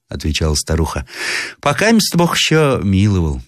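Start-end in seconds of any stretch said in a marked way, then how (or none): 1.6-3.4 high-pitched granny voice; old woman. A narrator's reading. Old woman says French have not come, high granny voice. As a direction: answer as a village granny.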